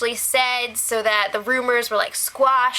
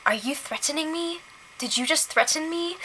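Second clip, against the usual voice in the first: softer, higher mock Canadian accent; imitated Canadian accent; 4 semitones higher, 7 dB quieter than usual. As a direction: mimic an uncertain Canadian accent, softly challenging. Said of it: "all of the Canadian accents".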